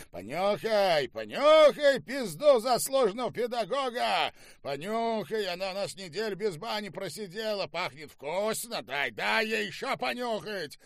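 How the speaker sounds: high-pitched, jeering singsong insistence; cruel taunting. A narrator's reading. General taunts the restrained prisoner, high jeering voice.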